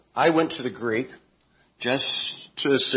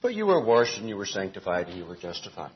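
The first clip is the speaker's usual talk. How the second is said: softer and fast, all in one breath; quick flat reading; 6 semitones lower, 5 dB quieter, faster than usual. Shown as a demonstration. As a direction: run it together, no emphasis. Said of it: "as the E S V and some other translations put".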